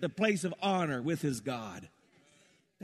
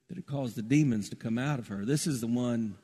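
ordinary talk, nothing marked

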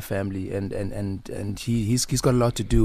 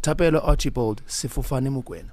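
ordinary delivery

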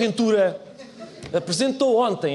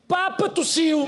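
mock dramatic headline; 9 semitones higher, much slower than usual.

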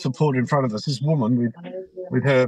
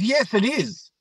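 higher, indignant retort; imitated irritation; 6 semitones higher, slower than usual. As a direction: act out her annoyed comeback. Retold quarrel, annoyed woman answers.

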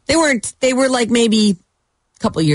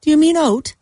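questioning; 11 semitones higher than usual. Retold or imitated. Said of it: "And he says".

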